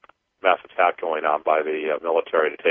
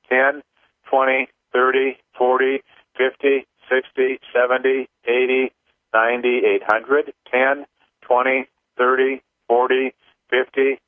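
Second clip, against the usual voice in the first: higher, steady chant-like counting; rhythmic counting; 6 semitones higher than usual. Acted. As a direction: tick off each number in rhythm. Leads a clearing count.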